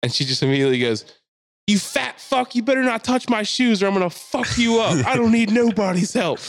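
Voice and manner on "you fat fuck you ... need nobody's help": high, raised-voice tirade; imitated screaming meltdown. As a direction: imitate a woman ranting at a bystander. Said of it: "this woman's screaming at this guy".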